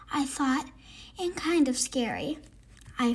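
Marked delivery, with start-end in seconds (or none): none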